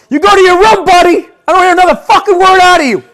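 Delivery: high, loud furious parent; yelling parent. Pretend. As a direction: send the kid to his room.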